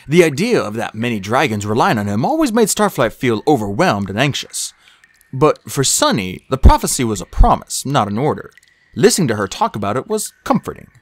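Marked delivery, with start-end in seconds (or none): none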